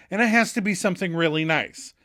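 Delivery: ordinary talk, nothing marked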